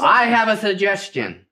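slow, high, booming announcer read; fighting game announcer. Pretend. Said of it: "Use your announcer voice".

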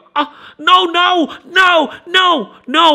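loud, high shrieking no no; horrified no. Retold or imitated. Lindsay shrieks no at the camera, loud and high. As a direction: scream no over and over. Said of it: "ลินเซ่แม่งก็".

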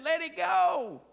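loud, slow command; Jesus commanding. Retold or imitated. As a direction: release them with a firm call. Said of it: "But Jesus said".

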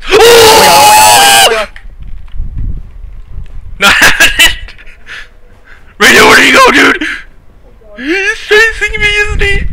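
high frantic panicked yelling; panicking gamer. Real, not acted. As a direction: yell in terror while fleeing. Chased by monster in game.

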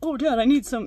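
high, loud mock pleading; Sammy/private. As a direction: mimic a boyfriend asking his dad for things. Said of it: "Sammy just like".

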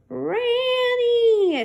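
high, loud, drawn-out screech of the name; screaming raccoon. A narrator's reading. Raccoon screaming at Francine on the roof, high drawn-out screech. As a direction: shriek it long and high like a wild animal. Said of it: "screamed the raccoon".

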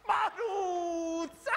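long, high-pitched call. Acted. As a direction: draw the name out in a high holler. Calling out to Maruzza.

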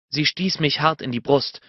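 normal talking voice

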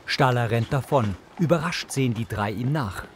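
ordinary talk

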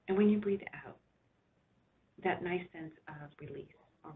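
unhurried relaxation voice with long pauses; guided meditation. Real, not acted. Doctor guiding listeners to rest back and relax, soothing guided imagery.

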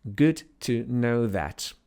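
"Good to know that" very slowly, each word apart; slow clear repeat. Shown as a demonstration. Teacher slowly repeating "Good to know that" for students. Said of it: "Co ja powiedziałem?".

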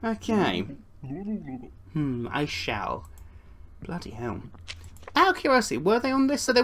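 ordinary talk

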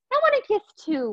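usual speaking voice

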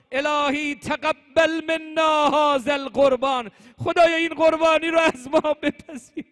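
high, loud elegiac recitation; impassioned, chant-like rawzah delivery; mourning lament. Acted. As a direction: wail the elegy out at full force. Climax of mourning elegy.

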